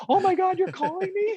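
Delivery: squealing very high, loud, thrilled; a fan shrieks on hearing who called, very high and loud; excited scream. Retold or imitated. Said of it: "She screamed".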